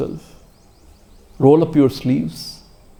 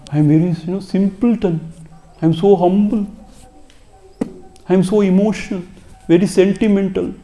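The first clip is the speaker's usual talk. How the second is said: higher, mocking the proud humble one; mock self-praise; 5 semitones higher than usual. Teacher mocking a sentimental disciple, lightly sing-song mock. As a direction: parody someone boasting how humble they are.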